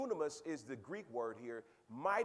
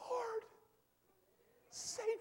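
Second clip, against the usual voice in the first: very high pleading cry; mock cry for help; slower than usual. New convert begging the Lord, hammed-up high plea. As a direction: cry out like a repentant sinner. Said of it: "when they cry".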